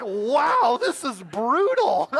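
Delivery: loud, drawn-out comic disbelief; hammed-up stunned reaction; mock astonishment. Acted stunned reaction to insult.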